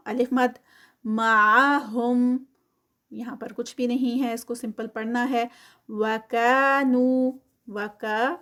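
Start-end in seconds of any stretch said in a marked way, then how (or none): 1.0-2.4 معہم very slow, a bit louder; recited slowly. Shown as a demonstration. Teacher reciting the next word. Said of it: "ٹو counts". 5.9-7.3 وکانو slow, louder, کا and نو each held two counts; two-count madd. Shown as a demonstration. Teacher demonstrating two-count madd. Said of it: "کا پہ بھی ٹو counts".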